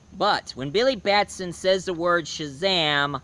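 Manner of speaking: ordinary delivery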